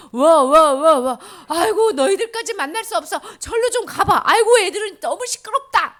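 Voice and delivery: loud, high, exasperated shouting; shooing disciples. Acted. Disciples shouting at the noisy children to go away.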